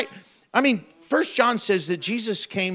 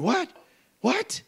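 stunned disbelief; 8 semitones higher, 4 dB quieter than usual. Pretend. Zacchaeus stunned.

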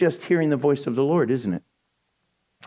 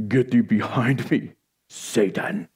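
low, menacing command with pauses; Jesus with glowing-eyed authority; ominous rebuke; 8 semitones lower than usual. Acted. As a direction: banish the devil in a deep, hushed voice. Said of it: "I wonder if his eyes glowed".

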